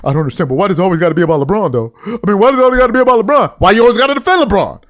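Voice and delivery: fast, breathless whine of a player defending LeBron; mock rapid whining. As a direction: rattle it off like an offended teammate. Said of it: "N B A players running around defending dude".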